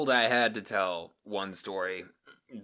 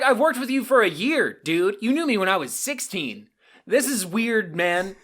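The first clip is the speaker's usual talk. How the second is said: loud, much higher exasperation; high indignant rant; 10 semitones higher, 7 dB louder than usual. Retold or imitated. Teen scolding the coworker, loud and high. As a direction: complain like a wronged kid.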